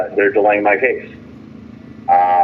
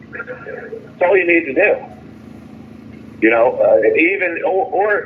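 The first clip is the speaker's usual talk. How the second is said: exasperated; 8 semitones higher than usual. Real, not acted. Exasperated rant about cheap laptops.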